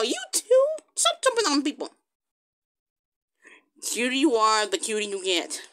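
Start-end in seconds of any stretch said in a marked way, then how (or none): 0.0-1.9 high-pitched, incredulous telling-off; exasperated scolding. Pretend. Catching Cricket Paw jumping too, scolding. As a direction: squeak out a scolding to a naughty pet.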